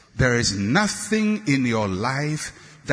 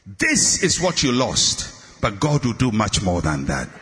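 ordinary talk, nothing marked

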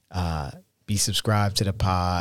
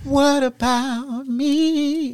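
high, slow, drawn-out whine; whiny what about me; much slower than usual. Acted. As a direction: stretch it out like a sulking child. Husband teasing, drawn out.

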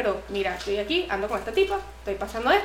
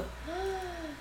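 sharp, breathy gasp of shock; mock gasp at the one-hour wait; 6 semitones higher, 15 dB quieter than usual. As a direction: gasp in horror. Hears the bus takes an hour.

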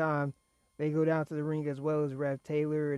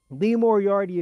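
all caps; 4 semitones higher, 8 dB louder than usual. Shown as a demonstration. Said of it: "I'm gonna say it in all caps".

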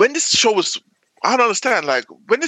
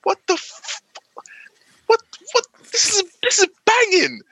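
high, stunned what-the reaction; shocked delight; amazed exclamation. Retold or imitated. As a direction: gasp out your disbelief, then rave. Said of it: "I'm like".

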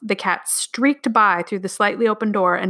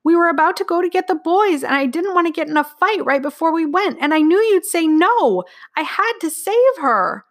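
put-on high, distraught child's outpouring; tearful pleading; 10 semitones higher, 4 dB louder than usual. A narrator's reading. Girl justifies rescuing the cat to parents, near tears. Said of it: "Her heart was pounding and tears were ready to burst".

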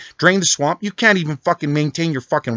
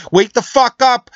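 louder, higher and slower, roaring the words out; furious shout; 9 semitones higher, 6 dB louder, slower than usual. Real, not acted. Ranting caller screaming at President Trump, furious shout. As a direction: yell it at him full force, stretching each word.

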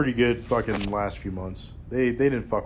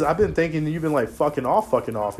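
ordinary delivery

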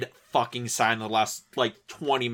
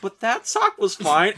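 lightly indignant; 6 semitones higher, 4 dB louder than usual. Retold or imitated. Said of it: "I was like".

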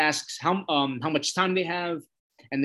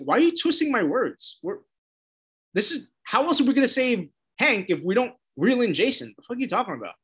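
higher, exasperated pretend rant; mock frustration; 7 semitones higher than usual. Vlogger pretending to be Dick arguing with Dove, exasperated. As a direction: argue back as if you were Dick.

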